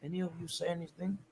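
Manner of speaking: put-on tentative first try at Sir Alex, without an accent yet; unsure Ferguson voice. Mocking Sir Alex's warning to the journalists, very soft and hesitant. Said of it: "I don't even know what accent to do".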